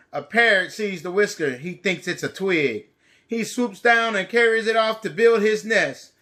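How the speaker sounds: lively, bright storybook reading; reading to children. A narrator's reading. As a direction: read it big for little kids. Storybook narration.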